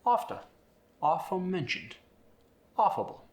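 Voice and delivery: British broad AH opening After, aforementioned, affable; high-pitched British 'ahfter', 'ahforementioned', 'ahffable'; British accent, long A as AH; British AH sound. Shown as a demonstration. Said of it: "you're gonna get that ah sound in there".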